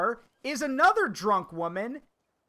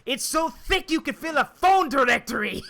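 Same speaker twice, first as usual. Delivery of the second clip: gruff commander; 5 semitones higher, 6 dB louder than usual. Retold or imitated. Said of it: "the commander was like".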